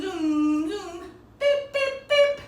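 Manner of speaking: sing-song zoom then high beeping horn; cheerful car sound effects for kids; car noises. Pretend. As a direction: play a toy car tooting its horn. Driving pretend car with kids.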